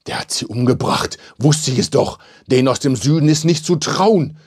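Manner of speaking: hurried, whiny outcry; agitated wailing. A narrator's reading. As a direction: lament in a rising panic. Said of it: "jammerte Krischan".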